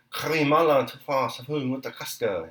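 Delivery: sing-song nonsense, slow and showy; expressive gibberish. A narrator's reading. Sidorov mimicking French to the soldiers, expressive gibberish. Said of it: "trying to give an expressive intonation".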